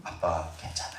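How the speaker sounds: low, soft comforting words; gentle reassurance. Acted. Child comforting dad after his business failed, gentle.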